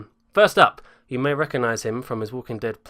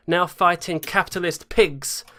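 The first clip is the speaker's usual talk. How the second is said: normal talking voice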